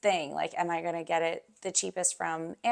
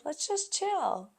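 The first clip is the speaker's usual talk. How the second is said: high, drawn-out quoted suggestion; husband's laid-back suggestion; 10 semitones higher, slower than usual. Retold or imitated. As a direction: imitate a husband proposing to relax, high and slow.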